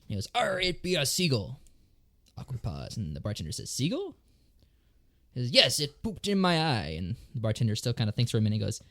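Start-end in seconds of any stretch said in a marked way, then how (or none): 0.3-1.6 higher, slow growly pirate "arr"; hammed-up pirate. Pirate quoted in joke. 3.7-4.1 much higher, rising disbelieving "Seagull?"; the bartender can't believe what he heard; puzzled bartender. Acted. 5.5-7.0 slow pirate reply. Acted. Pirate answers in joke.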